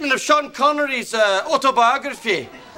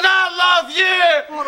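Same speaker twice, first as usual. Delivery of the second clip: emotional outburst; 4 semitones higher, slower than usual. Acted. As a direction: wail it out, overcome with love. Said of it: "I get so emotional".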